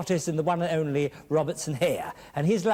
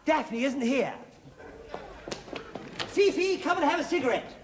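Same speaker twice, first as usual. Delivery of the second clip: flirty posh husband; 7 semitones higher than usual. Acted.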